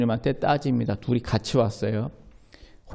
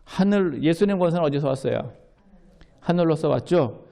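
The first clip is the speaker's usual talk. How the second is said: ordinary talk, nothing marked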